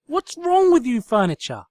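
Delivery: loud, high exasperated cry; loud frustrated exclamation; exclaimed. Acted. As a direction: throw it at the room. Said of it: "she exclaimed".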